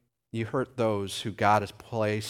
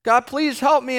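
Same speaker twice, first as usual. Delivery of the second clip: higher, louder pleading cry; desperate mock prayer; 13 semitones higher, 9 dB louder than usual. Preacher voicing a suffering believer praying, pleading higher. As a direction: beg God like a sufferer. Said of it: "And again".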